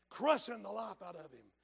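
normal talking voice

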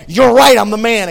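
high loud admission; fervent shouted confession. Acted. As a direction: cry out the guilt. David confessing.

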